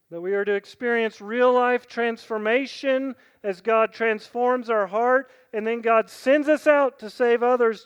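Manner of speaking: loud, ringing call to decide; full-voiced altar call; preaching at full force. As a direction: preach it out, building.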